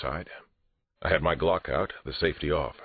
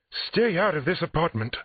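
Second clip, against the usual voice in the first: very high, raised-voice order; near scream; 4 dB louder than usual. A narrator's reading. Therapist to patrolmen entering, close to a scream. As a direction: shriek it, just short of screaming. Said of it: "raised her voice close to a scream".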